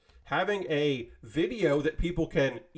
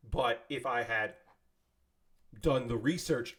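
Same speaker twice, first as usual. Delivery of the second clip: ordinary delivery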